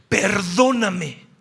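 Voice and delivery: ordinary talk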